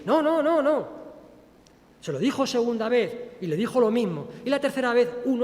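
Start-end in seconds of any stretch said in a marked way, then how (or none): 0.0-0.9 high, loud panicked no's; Peter's loud, panicked denial; frantic denial. Acted. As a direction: deny it in a rush of fear. Peter denies knowing Jesus.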